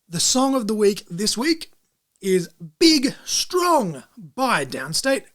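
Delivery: slow, drawn-out announcer read. Acted. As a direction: announce it like a show segment. Announcing song of the week.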